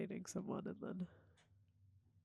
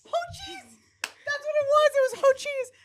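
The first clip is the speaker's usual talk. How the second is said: very high, loud squeal of recognition; thrilled, high-pitched outburst; real excited shriek; 13 dB louder than usual. Real, not acted. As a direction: shout it as if the answer just came back. Recognizes the forgotten phrase, shouts it.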